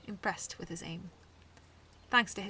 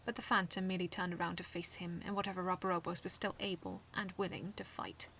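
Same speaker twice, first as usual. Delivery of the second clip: plain reading voice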